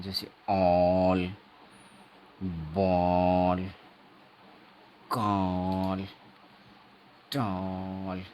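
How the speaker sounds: "all, ball, call, tall" with a long rounded vowel, "all" very slow; teacher modelling the long vowel in "all, ball, call, tall"; long vowel demo. Shown as a demonstration. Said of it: "इसमें आ को लंबा बोला जाता है".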